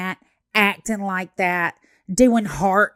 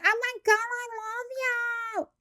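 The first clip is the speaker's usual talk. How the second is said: mock fangirl squeal; 14 semitones higher, 6 dB quieter than usual. Fan calling to a Disney princess, higher mock squeal. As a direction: squeal up high like a giddy fan. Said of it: "screaming and waving at her and saying".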